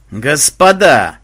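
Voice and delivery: loud, slow thunderous address; stern booming. Acted. Banzai addressing his testers from the heavens, stern boom. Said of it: "сурово прогремел с небес голос Банзая".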